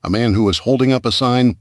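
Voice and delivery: plain reading voice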